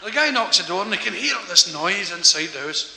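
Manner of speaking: ordinary talk, nothing marked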